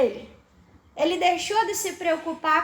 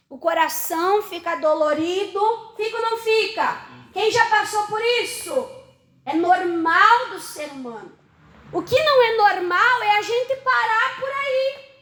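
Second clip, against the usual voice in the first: usual speaking voice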